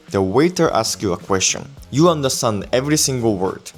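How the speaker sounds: normal talking voice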